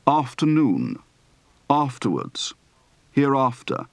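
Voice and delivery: "after" as AHF-tuh in each word; long ah in "Afternoon", "afterwards", "hereafter"; broad open-throated A. Shown as a demonstration. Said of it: "Practice for the broad, open-throated A".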